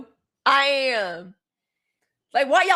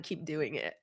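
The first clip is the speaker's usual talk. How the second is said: far softer, much lower aside; near whisper; 10 semitones lower, 15 dB quieter than usual. Real, not acted. Begging Carrie to keep going, in a hushed aside. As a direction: drop to a hushed confiding aside.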